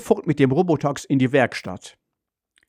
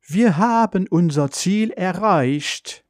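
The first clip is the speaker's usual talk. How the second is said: slow, higher-pitched announcing voice; robot car announcement; 6 semitones higher, slower than usual. A narrator's reading. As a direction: announce it slowly as the car's computer. (langsame Roboteransage mit höherer Stimme)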